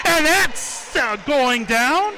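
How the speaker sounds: loud, high, drawn-out celebration; excited score call. Real, not acted. Commentator celebrates a three-pointer by Bogan, excited. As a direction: stretch out the call as it drops.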